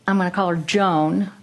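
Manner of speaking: usual speaking voice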